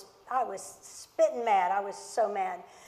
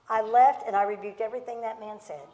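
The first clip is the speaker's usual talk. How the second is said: ordinary talk, nothing marked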